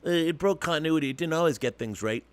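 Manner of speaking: a hint of a skeptical viewer pushing back; imagined fan objecting. Host voicing a fan who doubts him, lightly mock-skeptical. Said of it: "I'm sure some of you are thinking".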